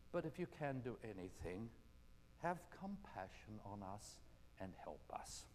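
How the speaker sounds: low, soft, slowing plea; pleading father. Acted. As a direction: beg for help, voice sinking low. Father pleads with Jesus for help.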